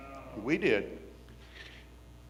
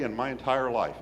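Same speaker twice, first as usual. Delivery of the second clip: ordinary talk, nothing marked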